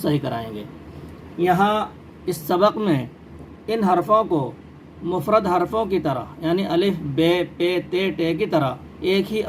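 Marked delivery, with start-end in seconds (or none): none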